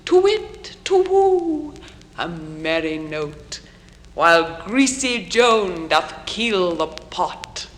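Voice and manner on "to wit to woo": high, ringing owl call, loud and long; owl's song. A narrator's reading. Reader gives the staring owl's refrain, high singing hoot. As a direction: hoot it high, stretching each woo. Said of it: "then nightly sings the staring owl".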